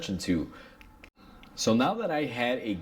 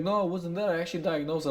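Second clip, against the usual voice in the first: higher-pitched mock whine of a defensive coworker; 7 semitones higher, faster than usual. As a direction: lightly parody the coworker insisting he figured it out. Coworker Nick's defensive retort retold.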